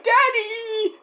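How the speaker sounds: high tearful cry of daddy; crying child. Retold or imitated. Crying kid calling out to hurt dad, high and tearful. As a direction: wail it like a scared kid. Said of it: "crying like little bitches".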